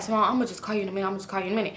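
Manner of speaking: soft, rushed, secretive brush-off; imitating a hurried half-whisper on the phone; mock hushed voice. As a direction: murmur it fast to get off the call. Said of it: "he kinda, like, whispering".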